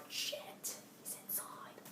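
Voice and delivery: slow, voiceless whisper of alarm; scared whisper. Retold or imitated. Teen retelling hearing the door unlock, scared whisper. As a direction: whisper it as if the intruder could hear. Retold fear of an intruder.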